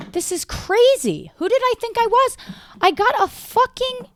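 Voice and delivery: mock your past self in a tiny voice; squeaky disbelieving self-mockery. Mocking her own shopping spree.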